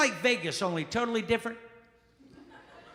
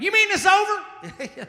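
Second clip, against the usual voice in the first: much higher, loud, slow laughing yelp; shocked yokel; 13 semitones higher, 7 dB louder, slower than usual. Retold or imitated. Alabama guy shocked the Civil War is over, high squeal. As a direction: squeal it in disbelief, stretched out. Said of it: "the guy went".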